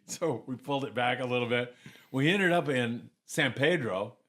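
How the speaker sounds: ordinary delivery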